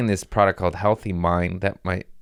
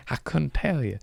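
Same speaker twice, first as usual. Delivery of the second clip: laughing; 7 semitones higher, 4 dB quieter than usual. Real, not acted. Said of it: "Why am I talking like that?".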